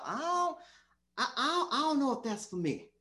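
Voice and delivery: high, stammering reluctance; acted hesitation. Preacher playing a doubter answering God, high hesitant stammer.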